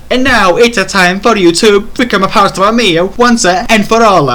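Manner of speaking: high, loud hammed-up Italian accent. Zuimama threatens the space pirate, hammy Italian accent. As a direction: sing it out as a cartoon Italian. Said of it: "said Zuimama in an Italian accent".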